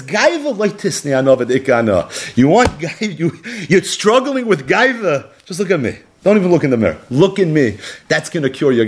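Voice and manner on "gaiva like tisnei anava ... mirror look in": high-pitched, emphatic admonition; impassioned preaching. Acted. Preacher admonishes those struggling with pride, impassioned. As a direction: lift the key word high, then press the point.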